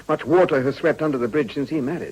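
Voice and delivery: normal talking voice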